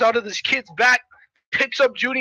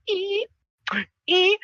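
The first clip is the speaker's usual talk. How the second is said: high, short "ee" squeaks; little squeals; 11 semitones higher than usual. Acted. Dude picking up coins, high little squeals. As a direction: squeak with each coin. Acting goggled coin picker.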